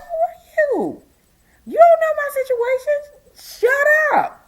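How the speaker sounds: high, loud tearful yell; tearful shout. Retold or imitated. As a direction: snap at the cashier in tears. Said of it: "But I snapped".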